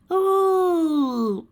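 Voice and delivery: high, loud long realization; dawning oh. A narrator's reading. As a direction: it finally clicks.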